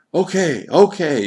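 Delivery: high, loud giving in; crying out. A narrator's reading. Johann yielding to the child at last, a high loud cry. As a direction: cry okay in surrender. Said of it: "Johann cried".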